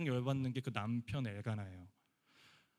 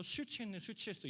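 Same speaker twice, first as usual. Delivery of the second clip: higher, softer sneer; sneering accusation; 7 semitones higher, 6 dB quieter than usual. Retold or imitated. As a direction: mutter a snide accusation. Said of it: "이렇게 이야기하고". (기도하는 여인을 비웃는 제사장 말투)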